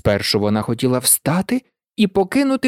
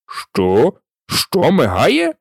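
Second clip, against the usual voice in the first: the king cutting in, baffled; much slower than usual. A narrator's reading. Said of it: "запитав король".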